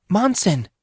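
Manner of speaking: high urgent cry of his name; a sudden high call; alarmed call. Acted. Cyan calls out to collapsed Monson.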